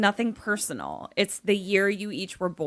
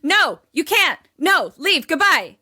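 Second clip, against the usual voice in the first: loud, higher, punchy dismissal; raised-pitch outburst, each phrase punched; emphatic mock refusal; 6 semitones higher, 5 dB louder than usual. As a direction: shoo the caller away loudly. Talking back to the creepy caller.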